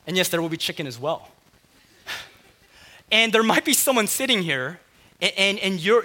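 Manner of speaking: usual speaking voice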